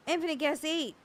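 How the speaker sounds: normal talking voice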